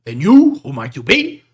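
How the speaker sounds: loud, high demand on the first word; gruff challenge. Acted. Big man demanding to know who the strangers are, loud. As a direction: confront the strangers bluntly. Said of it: "the big man just goes".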